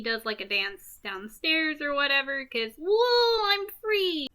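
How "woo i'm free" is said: high-pitched whoop of freedom; elated cry. Acted. Movie recapper acts out Joker dancing downstairs, elated. Acting out character's joyful release.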